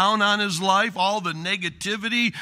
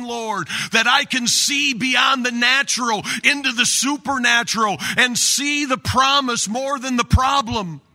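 higher, louder fervent preaching; preaching at full force; 6 semitones higher, 4 dB louder than usual. Acted. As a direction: build to a fervent prayer cry. Preaching rises to prayer.

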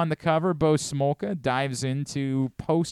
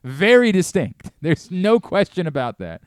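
ordinary talk, nothing marked

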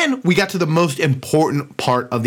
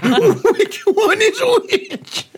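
confused Uncle Billy; much slower than usual. Retold or imitated. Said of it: "and he's like".

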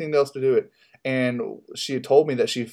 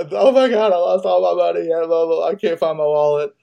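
panicked; 6 semitones higher, 4 dB louder than usual. Retold or imitated. Panicked texting after losing wallet.

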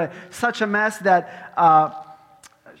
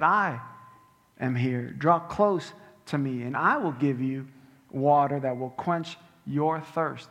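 an understated tender Jesus; tender invitation; 6 semitones lower than usual. Acted. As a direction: gently draw her close.

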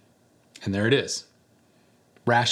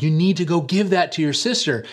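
higher, louder firm fatherly command; a dad running out of patience; stern dad; 6 semitones higher, 4 dB louder than usual. Retold or imitated. Dad ordering his son to hand over the cone, higher louder stern command.

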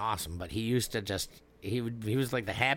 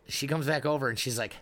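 ordinary delivery

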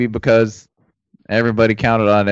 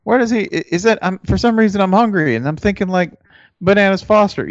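higher, wondering and confused; puzzled fan voice; 10 semitones higher than usual. Retold or imitated. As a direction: muse aloud, half hungry. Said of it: "you're like".

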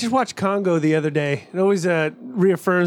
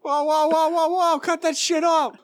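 high repeated whoa, pleading to stop; alarmed monster; 9 semitones higher than usual. Retold or imitated. Monster begging them to stop, alarmed. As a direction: wave it off, higher and higher.

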